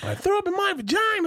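put-on very high little-girl wail; daughter's yell. Dad repeating his daughter's announcement, high childlike voice. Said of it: "at the top of her lungs is going".

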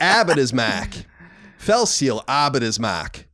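put-on slow Boston accent with dropped r's in "Abbott is Mac". Repeating the listener's request in a slow Boston accent. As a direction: drag it out like a Bostonian. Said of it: "pronounce Fell Seal Arbiter's Mark with a Boston accent".

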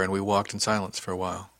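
ordinary delivery, nothing marked

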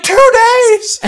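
very high, loud disbelieving shout; incredulous yell. Retold or imitated. Ranger shouts back across the river, stunned.

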